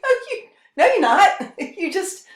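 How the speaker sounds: very high, loud, laughing denial; laughing retort. Retold or imitated. Speaker mocking the crown thief, laughing scornful denial. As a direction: scoff through laughter at the fake king.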